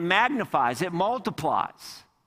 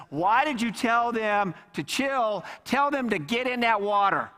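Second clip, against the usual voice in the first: higher-pitched acted reprimand; exasperated rebuke; 5 semitones higher than usual. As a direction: scold in disbelief, voice raised in pitch. God acted out rebuking Moses.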